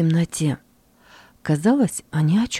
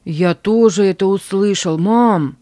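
from another room; 3 semitones higher, 5 dB louder than usual. Acted. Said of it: "раздался голос из соседней комнаты".

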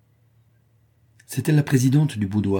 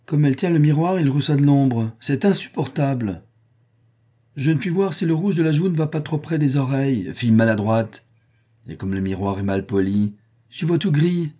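higher, peevish scolding of the maid; acerbic complaint; 6 semitones higher, 3 dB louder than usual. A narrator's reading. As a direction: grumble sharply at the dull mirror. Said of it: "reprit Eugraphia d'un ton acerbe".

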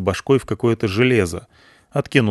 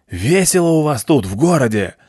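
shouting over engine; 5 semitones higher than usual. A narrator's reading. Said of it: "заорал, перекрикивая двигатель".

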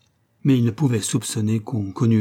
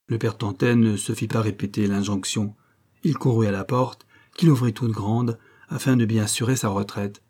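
usual speaking voice

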